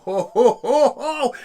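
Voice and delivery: rising gleeful ho-ho laugh; mock evil glee; gleeful chuckle. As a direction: relish the moment. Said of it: "And I thought".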